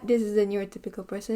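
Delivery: normal talking voice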